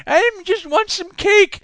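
put-on slow high child voice; odd child voice. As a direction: squeak like a strange little boy. The same child Jimmy.

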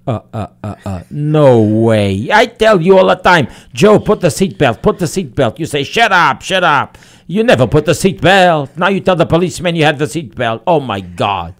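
high, loud, indignant wife; nagging wife. Acted. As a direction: build from stuttered disbelief into a loud scolding. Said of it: "The wife goes".

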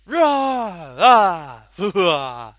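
loud roaring monster growls; playful monster chase. Pretend. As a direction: roar then laugh it off. More monster roars, laughing.

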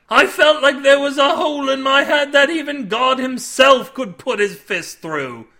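loud, high wail of remembered misery; aggrieved sailor. A narrator's reading. Sailor recalling a terrible headache, anguished. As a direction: exaggerate the awful morning after.

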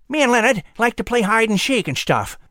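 high squirrel voice with whistled esses; proud little squirrel, lisping through buck teeth; whistling through teeth. Acted.

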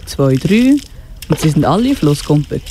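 plain reading voice

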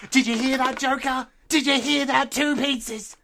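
high, sing-out call through a door; mock calling out. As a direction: call it out high to someone out of sight. Calls through door to Joker.

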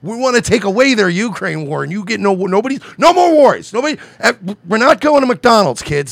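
put-on high, loud parent telling off the kids; mock scolding parent. Mocking politicians, fed-up parent scolding kids. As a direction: shout it like a fed-up mom in the car.

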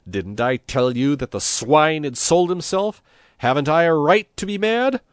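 high, loud, rising indignation; outraged complaint. A narrator's reading. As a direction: demand vindication, fuming. Angry priest complains to Christ.

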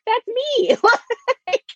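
high squeaky declaration; excited that's me. Retold or imitated. Relating to the sister character, high excited claim. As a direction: proudly claim it as yourself. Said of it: "I'm like".

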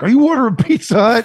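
angry yelling husband. Pretend. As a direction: bellow it like a jealous husband. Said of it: "he's like".

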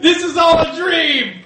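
slow, high shouted denial; mock heroic shout. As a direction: bellow it like a deluded hero. GM continuing mocking shout.

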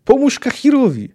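high, loud urgent plea; acted scream. A narrator's reading. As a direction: yell for someone to help a wounded friend. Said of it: "wrzasnął Angolem".

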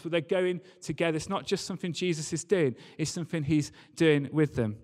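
normal talking voice

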